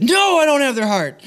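high, whiny comic wail; exaggerated high-pitched blubbering reply; mock tearful admission. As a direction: wail the confession in a hammed-up cry. Acting his tearful answer to wife.

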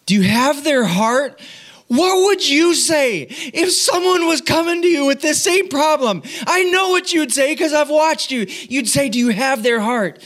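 high-pitched mock sobbing wife; tearful wife. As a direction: imitate a wife pleading through snot and tears. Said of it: "through snot and tears of her own".

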